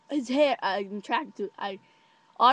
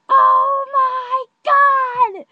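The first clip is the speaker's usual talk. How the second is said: very high, drawn-out shriek; excited yell; 15 dB louder, much slower than usual. Retold or imitated. Spotting the boy while walking with friend, excited yell. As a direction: yell it at full volume. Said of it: "And I yell".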